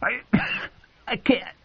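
high, strangled gasps; choking. Acted. Dying king chokes on his words to the hall. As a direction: barely get the words out.

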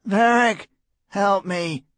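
thin high pleading cry; a tiny, feeble insect voice; fluttering voice. A narrator's reading. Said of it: "His voice came out thin and tiny, a feeble, fluttering insect voice".

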